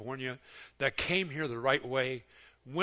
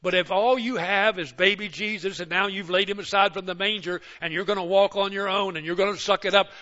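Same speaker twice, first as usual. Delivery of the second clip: ordinary delivery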